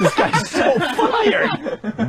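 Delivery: high, very slow laughing words. Real, not acted. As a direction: stretch it out while laughing. Laughing at the cursing DJ.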